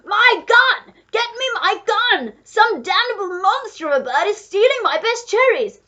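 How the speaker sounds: high, very loud outraged ranting; duke shouting. A narrator's reading. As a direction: roar at a thief and demand your weapon. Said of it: "the Duke was shouting".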